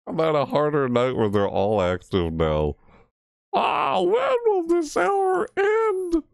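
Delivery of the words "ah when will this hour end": slow, very high drawn-out whine; despairing wail. Real, not acted. Player on harder night, despairing wail. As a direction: wail it slowly, voice way up.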